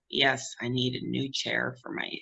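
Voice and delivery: low, slow, subdued pretend request; mock reluctant request. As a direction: act out dreading the request, voice dropped. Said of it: "worst nightmare ever is to go".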